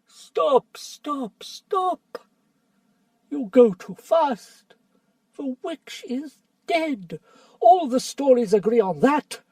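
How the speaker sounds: calm the fighters urgently; anxious old doctor. Acted. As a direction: scholar begging the council to stop. Doctor Cornelius intervenes.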